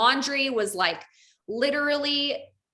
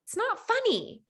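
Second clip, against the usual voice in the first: much higher, frightened plea; panicked; 13 semitones higher than usual. Retold or imitated. Wife pleading with her unconscious husband, panicked and high. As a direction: beg him to stop in rising fear.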